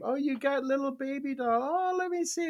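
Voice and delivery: put-on high, soft baby-talk coo; doting dad. Dad asking to see daughter's baby doll, cooing. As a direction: gush softly over a child's doll.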